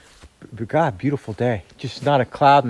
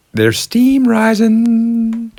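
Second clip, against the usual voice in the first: delighted; 12 semitones higher, 7 dB louder, much slower than usual. Real, not acted. His stove works.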